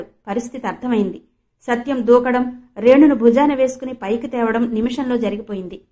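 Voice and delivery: ordinary delivery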